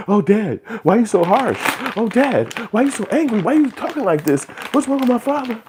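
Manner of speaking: very high-pitched mock child voice; whiny little kid. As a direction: plead in a tiny, high kid voice. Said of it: "you're a little kid".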